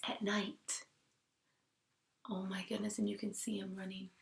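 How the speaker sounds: hushed storytelling whisper. A narrator's reading. Teacher whispering the suspense to her class. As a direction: breathe it like a secret.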